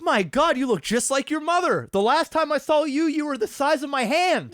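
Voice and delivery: high, loud, amazed gushing relative; cheek pinching aunt. Retold or imitated. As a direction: marvel at the kid like an old uncle. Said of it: "I was that fucking guy where I'm going".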